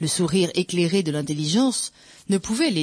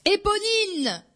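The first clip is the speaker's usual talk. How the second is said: high, held call of a name; shouted call; much slower than usual. A narrator's reading. Narrator calling Éponine up the ladder, crying out. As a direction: call out far upward, without much extra volume. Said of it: "Je criais de toutes mes forces".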